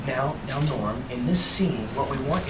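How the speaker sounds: softly mimicking a courteous director; gentle polite director. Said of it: "he doesn't speak to you like".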